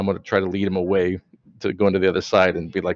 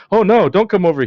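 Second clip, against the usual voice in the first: higher, louder hammed-up fake alarm; badly faked panic; 10 semitones higher, 7 dB louder than usual. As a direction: overplay a poor bluff that he's hiding something. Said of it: "just more like".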